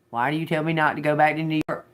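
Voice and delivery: real irritation, question fired off quickly; impatient demand. Real, not acted. Repeats question after getting no answer.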